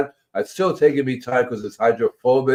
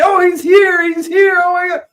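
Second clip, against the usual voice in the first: much higher and louder, squealing with joy; excited chickens; 4 dB louder than usual. Pretend. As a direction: hens thrilled he has arrived. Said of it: "when they see me".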